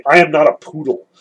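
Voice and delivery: ordinary talk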